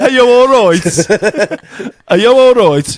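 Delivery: laughing mock Brummie; hearty imitation of a Birmingham accent. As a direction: lean hard on the Brummie vowels. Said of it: "I'm gonna go for Birmingham".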